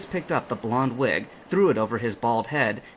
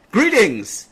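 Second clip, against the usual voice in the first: higher, louder hearty greeting; captain's greeting; 10 semitones higher, 9 dB louder than usual. A narrator's reading. The captain welcoming the trainers, hearty greeting. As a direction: call it out warmly. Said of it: "shouted the captain".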